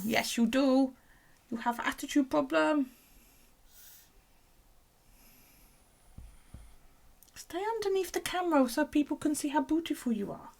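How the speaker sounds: high cooing pet voice. Real, not acted. Owner coaxing her snake in high cooing voice. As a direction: coax the snake sweetly.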